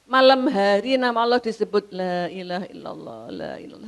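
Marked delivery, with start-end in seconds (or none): 1.9-3.9 tearful dhikr imitated. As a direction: murmur the phrase as if praying through tears. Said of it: "Sambil nangis". (zikir lirih menirukan orang menangis)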